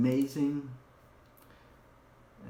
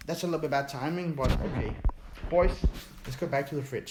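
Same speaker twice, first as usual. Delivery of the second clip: ordinary talk, nothing marked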